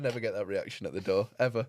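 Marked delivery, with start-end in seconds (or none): none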